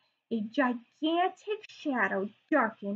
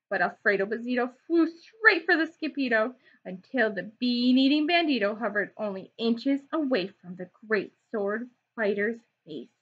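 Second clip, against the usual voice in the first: plain reading voice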